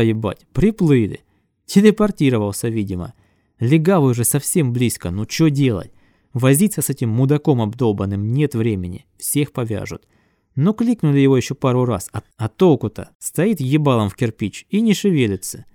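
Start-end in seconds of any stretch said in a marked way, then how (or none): none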